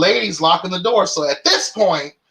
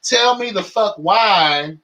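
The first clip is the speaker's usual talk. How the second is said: usual speaking voice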